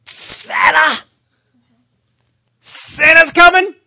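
high, slow, building shout; excited Buddy. Retold or imitated. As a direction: scream it like a kid at Christmas. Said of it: "He's excited".

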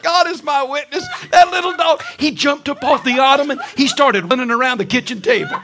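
high, loud laughing tale; laughing shout. Real, not acted. Laughing story climax.

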